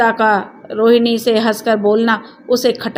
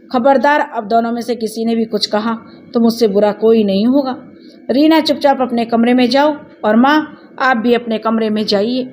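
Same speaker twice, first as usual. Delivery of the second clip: ordinary delivery, nothing marked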